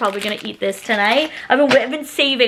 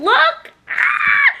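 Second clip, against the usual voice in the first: screaming with joy. Real, not acted. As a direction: shriek with excitement. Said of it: "I'm, like, screaming".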